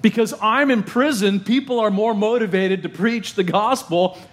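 high, lifted voice of rejoicing; a lightly voiced joyful Paul. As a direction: sound glad despite prison. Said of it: "He's rejoicing".

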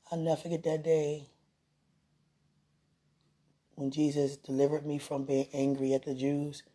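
softly spoken, heartfelt reflection; tender, reverent. Real, not acted. Speaker reflecting on God's care, tender and reverent.

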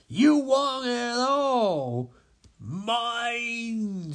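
high, drawn-out covetous voice; greedy villain. Acted. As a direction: stretch MINE out greedily. Greedy Lex Luthor is tempted.

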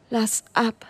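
"Lass ab" pressed out weaker, almost gone; a fading plea, "Lass ab"; strained, last strength. Acted. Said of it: "presste er mit letzten Kräften aus sich heraus".

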